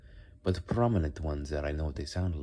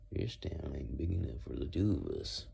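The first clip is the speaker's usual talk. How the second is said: Wild West accent; 5 dB quieter than usual. Shown as a demonstration. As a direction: quietly play a gunslinger in a Western accent. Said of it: "And then Wild West".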